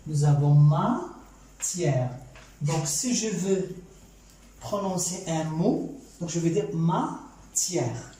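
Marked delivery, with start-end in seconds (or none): 0.5-2.1 "matière" stretched out in two separate syllables. Shown as a demonstration. Teacher counting syllables for learners, "matière" split syllable by syllable. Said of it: "nous avons deux syllabes". 6.7-8.1 "matière" slowly split as ma, tière; syllable by syllable. Shown as a demonstration. Teacher showing how to pronounce a word, "matière" split syllable by syllable. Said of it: "nous avons deux syllabes".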